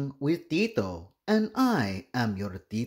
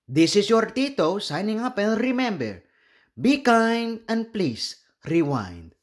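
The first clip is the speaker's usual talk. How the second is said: higher, fast, peppy goodbye; upbeat sign-off; 7 semitones higher, faster than usual. Real, not acted. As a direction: sign off with a bright catchphrase. Cheerful sign-off to viewers.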